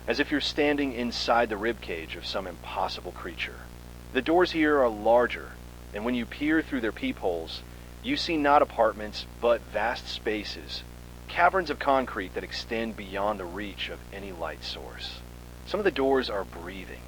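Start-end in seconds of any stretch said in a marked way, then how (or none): none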